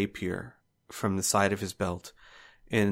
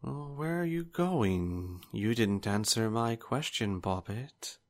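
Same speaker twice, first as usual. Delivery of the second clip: taunting villain; 2 semitones higher, 6 dB quieter than usual. Acted. Old swordsman taunts the retreating sister. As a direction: toy with her as she flees. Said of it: "he goes".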